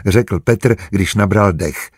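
usual speaking voice

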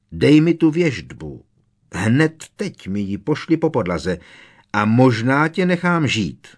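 loud threatening witch voice; Bellatrix demanding. A narrator's reading. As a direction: order him, menacing. (výhružný hlas čarodějnice)

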